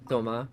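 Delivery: Toma with no S at the end; French "Toma", ending on the vowel; French pronunciation. Shown as a demonstration. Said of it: "it'll be pronounced Toma".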